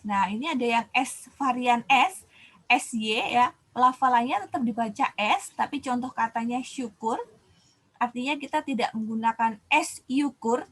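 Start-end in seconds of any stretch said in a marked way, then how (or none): none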